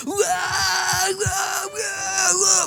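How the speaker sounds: very high, shouted cries of joy; cheering shout. Retold or imitated. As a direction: yell with delight as the ball drops in. Father cheering son's basket.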